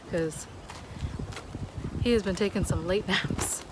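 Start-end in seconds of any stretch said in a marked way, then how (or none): none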